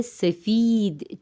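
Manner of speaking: سفید as "se-feed", with a long ee; Persian long ee. Shown as a demonstration. Teacher turns the Urdu word Persian, long ee pronunciation. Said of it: "چھوٹی یا کی آواز کے ساتھ".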